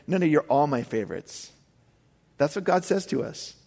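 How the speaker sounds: ordinary talk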